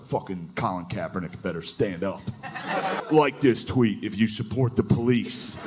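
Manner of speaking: low, slow dim hometown guy; a hometown guy posting, mock drawl. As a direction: drawl the tweet out dumbly. Hometown redneck tweet read.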